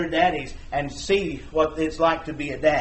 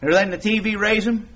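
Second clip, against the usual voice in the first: raised, ringing sermon delivery; preaching at full force; 5 semitones higher, 4 dB louder than usual. Acted. Preacher challenging parents about raising children, raised and ringing. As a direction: press the congregation hard, louder and higher.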